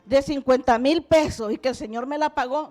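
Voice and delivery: booming hypothetical testimony; loud proclaiming. Retold or imitated. Believer supposes aloud he owed a debt, proclaimed very loudly. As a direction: project it hard to the whole room.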